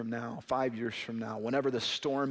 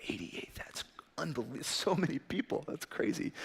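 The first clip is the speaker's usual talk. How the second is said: whispered thought; 3 semitones higher, 6 dB quieter than usual. Retold or imitated. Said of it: "I remember thinking".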